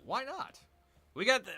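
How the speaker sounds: ordinary talk, nothing marked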